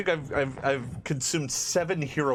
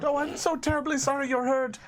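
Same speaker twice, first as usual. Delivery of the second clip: high, effusive sympathy; fussing farm woman; 13 semitones higher than usual. Acted. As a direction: gush an apology in a high woman's voice. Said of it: "she comes kind of rushing out".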